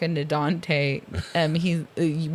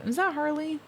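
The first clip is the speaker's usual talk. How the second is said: surprised recognition; 10 semitones higher, 5 dB quieter than usual. Retold or imitated. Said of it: "I was like".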